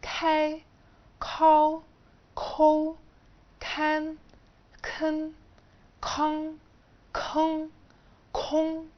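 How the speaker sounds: each syllable high and clear, breathy k; pronunciation drill with aspiration; ka syllables with a puff of air. Shown as a demonstration. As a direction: say كاي and كون with an aspirated k, like a teacher. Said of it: "هتنطق فيها ه".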